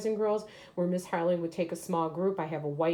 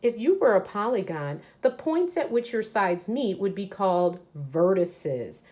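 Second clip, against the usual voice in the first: louder, brisk storybook reading; reading aloud to children; 2 semitones higher, 6 dB louder than usual. Acted. Teacher reading a picture book to her class, lively read-aloud. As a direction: read it out big and lively for the kids.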